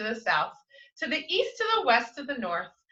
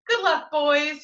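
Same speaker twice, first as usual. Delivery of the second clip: a higher, louder farewell call; cried out; 6 semitones higher, 6 dB louder than usual. A narrator's reading. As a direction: call it out after the departing bears. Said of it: "he cried".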